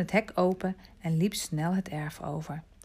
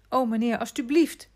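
higher, louder begging to the master; pleading; 6 semitones higher, 5 dB louder than usual. A narrator's reading. Boy begs Vitalis after being asked to walk on, pleading. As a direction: plead with him in a raised pitch. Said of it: "smeekte Remy".

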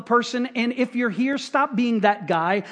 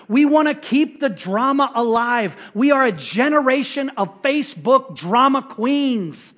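louder, building rebuke to the congregation; fiery preaching; 4 semitones higher, 4 dB louder than usual. Acted. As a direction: hammer each grievance home. Preaching at full force.

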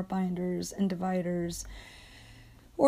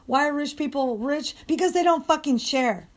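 higher, heated outburst; angry rant; 5 semitones higher, 4 dB louder than usual. Real, not acted. Worker rants at rich people who don't share, angry. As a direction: hammer it out in real exasperation.

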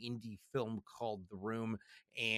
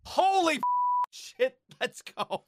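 hammed-up exclamation; 14 semitones higher, 7 dB louder, slower than usual. Button sound effect played.

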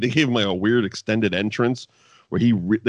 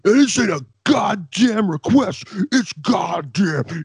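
higher, slow mock X-Pac growl; imitating X-Pac demanding a title shot; X-Pac impression; 9 semitones higher, 4 dB louder, slower than usual. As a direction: ham up an angry wrestler promo. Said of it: "he's like".

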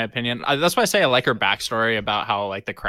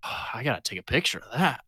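retold dad line; 7 dB quieter than usual. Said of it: "the way he said it".

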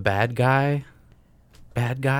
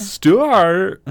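Stuart impression; 11 semitones higher, 10 dB louder than usual. Character name called out in voice.

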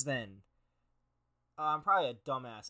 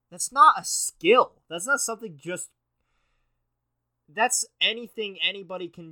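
indignant outburst; 6 semitones higher, 15 dB louder than usual. Real, not acted. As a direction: scoff it's not a skill, loudly. Ranting at horoscope text.

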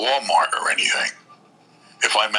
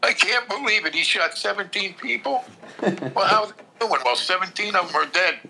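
high-pitched imitation of shocked neighbours; mock disbelieving bystander; 8 semitones higher than usual. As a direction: play a stunned acquaintance, pitched up. Same shocked bystander voices, acted.

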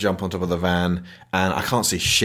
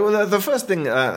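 ordinary delivery

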